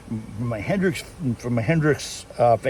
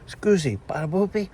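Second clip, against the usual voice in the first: mock fake Italian; 7 semitones higher than usual. Vlogger imitating Peter's fake Italian, lightly high. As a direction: put on a silly fake Italian voice. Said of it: "he goes".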